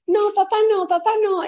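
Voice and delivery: put-on high, squeaky little girl refusing her dad; whiny toddler. Toddler pushing her dad away, high squeaky whine.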